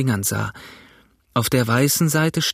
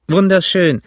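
louder, higher mutineer voice; George, mutineer; 7 semitones higher, 7 dB louder than usual. A narrator's reading. George answers the mutineer about the map, loud and high. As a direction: call it out high and strong. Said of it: "sagte George".

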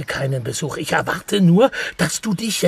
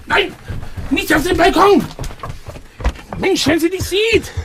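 panicked; 11 semitones higher than usual. Acted. Stops friend going onto the balcony.

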